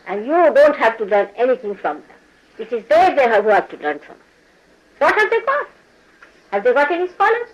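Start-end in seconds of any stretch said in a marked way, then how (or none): 5.0-7.5 scornful rhetorical question. Real, not acted.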